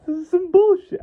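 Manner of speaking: high-pitched mock indignation; hysterical outrage. Curse monger reacting to his ruined curse, hysterical outrage. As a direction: squeal the complaint, hammed-up. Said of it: "imagine his hysterical reaction".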